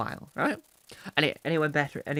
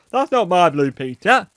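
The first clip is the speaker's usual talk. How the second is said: loud, higher, odd dad voice; father impression; 4 semitones higher, 12 dB louder than usual. Dad watching Blue Peter with his child, loud odd father voice. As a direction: belt it out as an affronted mock dad. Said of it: "with an odd voice".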